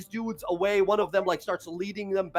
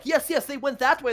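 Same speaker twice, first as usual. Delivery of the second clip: higher, eager fake helpfulness; misdirecting the guards; 9 semitones higher, 4 dB louder than usual. GM suggests Fang misleads guards, higher and eager. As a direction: point them the wrong way, pitched up and eager.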